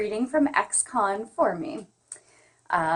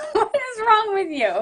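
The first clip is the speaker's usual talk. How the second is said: higher laugh-cry; 11 semitones higher than usual. Real, not acted. Wife laughing at her husband's limp joke. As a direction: laugh it out in disbelief.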